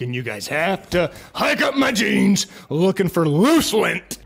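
ordinary delivery

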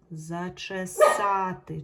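teacher stressing the с in "Зачесати"; loud slow check word. Shown as a demonstration. Teacher to pupils, loudly stretching the check word. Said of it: "Чути звук С".

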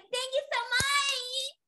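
high, loud, stretched squeal; gushing, drawn-out high cry; excited thanks. Acted. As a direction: shout the thanks with joy. Bella thanking parents excitedly.

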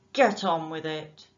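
loud rough order; shouting. Acted. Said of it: "he shouted".

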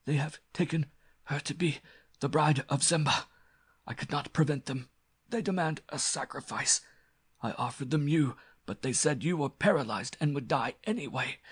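strained confession from a beaten foe; desperate cult priest, gasping. Acted. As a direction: plead your case after nearly being strangled. Choked villain explains himself.

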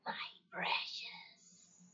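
slow, drawn-out creature whisper; creature impression. Shown as a demonstration. Assistant doing a requested impression, slow whispered creature. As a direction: whisper it slowly with long hissing sibilants. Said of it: "Do another impression".

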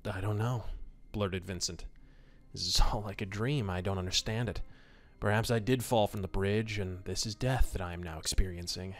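steady, low storytelling voice; story narration. A narrator's reading. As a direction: read it like an old pulp radio tale. Reading a pulp story aloud.

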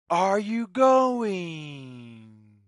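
ordinary delivery

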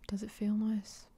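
usual speaking voice

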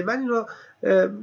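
ordinary talk, nothing marked

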